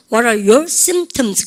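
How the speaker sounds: slow, loud model sentence; teacher drilling slowly; slow model phrase. Shown as a demonstration. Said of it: "시작".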